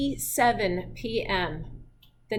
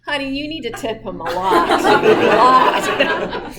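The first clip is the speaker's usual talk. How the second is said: laughing plea; 9 dB louder than usual. Retold or imitated. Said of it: "I'm like".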